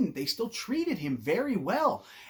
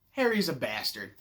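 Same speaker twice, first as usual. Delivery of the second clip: ordinary talk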